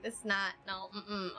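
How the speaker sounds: choked-up, fighting tears back; holding back tears. Real, not acted. Streamer thanking his viewers, choking up and holding back tears. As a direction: voice catching as he tries not to cry. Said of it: "I'm, I'm not crying".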